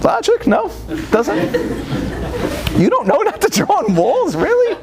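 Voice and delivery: very high, squeaky incredulity; dad baffled by son's logic; exasperated disbelief. Retold or imitated. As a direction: ask it as if it's absurd.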